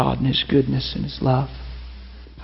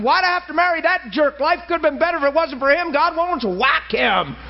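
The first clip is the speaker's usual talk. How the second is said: high-pitched, exasperated railing; heated, high accusatory prayer; indignant complaint. Acted. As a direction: act out a fed-up wife railing at God. Acts wife's angry complaint to God.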